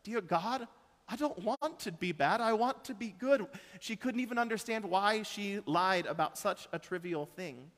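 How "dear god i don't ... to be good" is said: a hint of a high child's plea; a touch of a pleading five-year-old; lightly voiced little girl. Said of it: "our little five-year-old".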